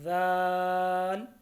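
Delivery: ज़ाल with a soft first sound and long aa; soft, lengthened. Shown as a demonstration. Teacher demonstrates a soft letter, ज़ाल drawn long. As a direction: stretch the aa in ज़ाल evenly. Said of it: "तीन से पाँच अलिफ के बराबर लंबा करके".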